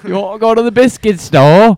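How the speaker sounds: loud, high-pitched mock British; British accent. Friend joking about a biscuit store, exaggerated British accent. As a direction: ham up a British accent, loudly.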